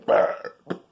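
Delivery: breathy fake dragon burp; fiery burp. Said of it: "let out a fiery burp".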